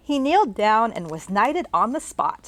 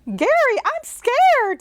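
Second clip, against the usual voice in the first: much higher, louder frightened cry; scared mother; 4 dB louder, slower than usual. Acted. The mother in the bathroom crying out to Gary in fear. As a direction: squeal it out in fright. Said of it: "said the woman".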